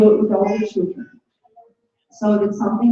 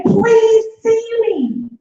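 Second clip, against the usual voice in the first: high, slow plea to be seen; crying daughter pleading; 12 semitones higher, much slower than usual. Retold or imitated. A scared daughter begging her mum, slow high plea. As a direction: plead like a frightened little girl. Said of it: "she's saying".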